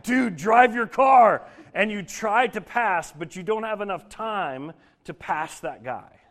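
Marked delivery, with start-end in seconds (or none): 0.0-1.4 loud, high, slow exasperated yell; stuck behind a slow driver, fed up and shouting; frustrated yell. Pretend. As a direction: yell at the slow car ahead.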